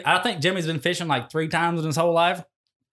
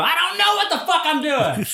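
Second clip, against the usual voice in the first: clueless confession; 13 semitones higher than usual. Retold or imitated. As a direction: blurt out total cluelessness. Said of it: "you go".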